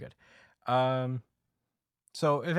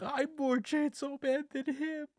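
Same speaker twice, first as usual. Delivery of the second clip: high, drawn-out fake wail; mock crying; slower than usual. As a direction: whine it like a hammed-up tearful protest. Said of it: "he just starts crying".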